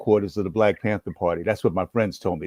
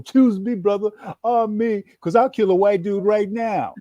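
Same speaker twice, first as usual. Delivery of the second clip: put-on much higher and louder, over-eager teen; eager shout; 11 semitones higher, 5 dB louder than usual. Skinny fifteen-year-old to Captain Lumumba at the meeting, eager high shout. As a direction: jump up and volunteer at the top of your voice. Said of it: "fifteen-year-old self and go".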